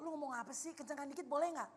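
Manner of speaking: hushed, barely projected voice; soft-spoken Solonese. Acted. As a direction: imitate someone too quiet to hear. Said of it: "Saya kayak pengen kasih mikrofon". (menirukan orang Solo bersuara pelan)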